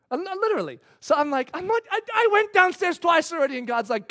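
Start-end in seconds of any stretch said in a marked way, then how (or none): 1.5-3.6 high, louder stammered objection; mock-aggrieved complaint; whiny protest. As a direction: protest like a put-upon kid. Dad protests to God.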